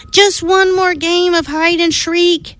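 put-on high pleading child's voice; pleading little mummy. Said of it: "he pleaded".

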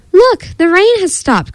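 high, mildly excited storybook cry. A narrator's reading. Froggy tells friends the rain has stopped, excited high cry. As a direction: announce good news in a high voice, without shouting. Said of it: "cried Froggy".

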